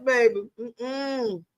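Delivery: ordinary delivery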